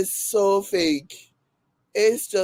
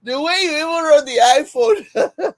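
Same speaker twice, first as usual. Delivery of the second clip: higher, louder, tumbling into laughter; cracking up; 6 semitones higher, 4 dB louder than usual. Real, not acted. Teasing a viewer's wording, cracking up. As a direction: say it on the edge of laughter. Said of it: "get me cracking up".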